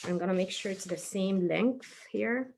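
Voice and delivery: ordinary talk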